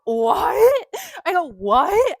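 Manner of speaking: loud high disbelieving what; incredulous what. Retold or imitated. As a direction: shout it in disbelief at the owner. Shocked retort to owner.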